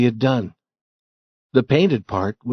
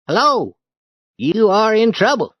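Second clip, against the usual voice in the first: put-on teasing boy; 10 semitones higher, 5 dB louder than usual. Ben teases Tom.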